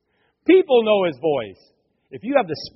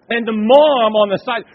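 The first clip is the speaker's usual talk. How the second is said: normal talking voice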